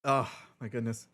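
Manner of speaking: talking through real hiccups; words broken by hiccups; hiccuping. Real, not acted. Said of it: "Sorry, I'm hiccuping".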